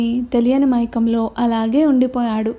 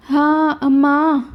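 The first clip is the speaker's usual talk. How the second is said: slow, drawn-out cry of pain; 3 semitones higher, much slower than usual. A narrator's reading. Girl whose arm he twisted, slow pained cry. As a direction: let the hurt stretch the words out.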